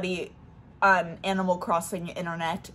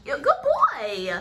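praising the dog; 8 semitones higher, 4 dB louder, slower than usual. Real, not acted.

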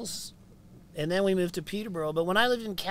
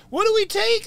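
high, louder worried question; frightened kids; 7 dB louder, faster than usual. Retold or imitated. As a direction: ask like an anxious child. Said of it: "my kids are like".

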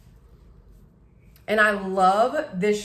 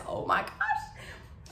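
breathy laughing gasp; 9 dB quieter, much slower than usual. Real, not acted. Delighted gasp at cute item.

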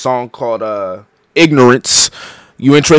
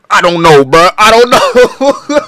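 high, slow, silly drawn-out voice; a lightly put-on goofy shrug; 9 semitones higher, 6 dB louder, slower than usual. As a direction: stretch it out like a clown. Silly reply to the grandma.

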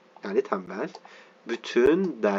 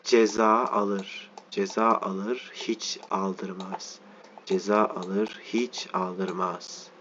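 slow reading aloud; 5 dB quieter, much slower than usual. Acted. Slow dictation-style reading.

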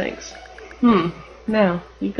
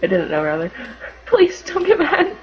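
tearful wife; 12 semitones higher than usual. Pretend. Pretend wife pleading with husband, high and tearful. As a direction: beg him through tears, high-pitched.

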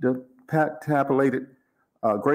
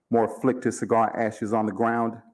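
usual speaking voice

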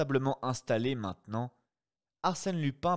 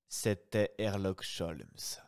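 ordinary delivery

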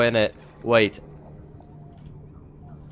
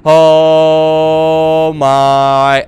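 long, loud, drawn-out wail; dismayed wail; 3 semitones higher, 10 dB louder than usual. Real, not acted. Player realizes he ignored the oxygen warning, dismayed wail. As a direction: wail it out as disaster hits.

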